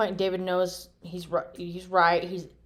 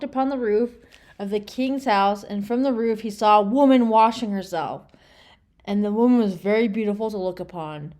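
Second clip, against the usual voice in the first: a solemn Bible reading, a bit louder; scripture reading; 2 semitones higher, 5 dB louder than usual. Acted. Reading Bible verses aloud to the viewers, solemn. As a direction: read the verses as holy text.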